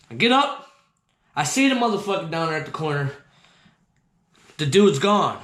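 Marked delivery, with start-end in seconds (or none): none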